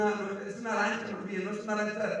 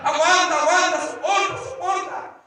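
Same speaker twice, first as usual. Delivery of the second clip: urgent urging; 8 semitones higher, 5 dB louder than usual. Retold or imitated. Urging wife while swept underwater.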